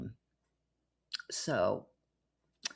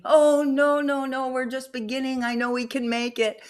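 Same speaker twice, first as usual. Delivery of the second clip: higher, coaxing plea, no no no; pleading scammer; 10 semitones higher, 5 dB louder than usual. Retold or imitated. Scammer begging her to stay on the site, higher pleading. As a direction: beg her not to quit the site, pitched up.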